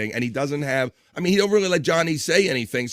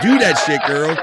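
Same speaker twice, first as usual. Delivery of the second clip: hyped cheer; 3 semitones higher, 6 dB louder than usual. Real, not acted. Host cheering on a day drinker.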